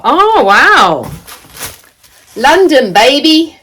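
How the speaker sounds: high, loud, delighted exclamation; thrilled. Real, not acted. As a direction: burst out with excitement at the gift. Growing delight on seeing the gift.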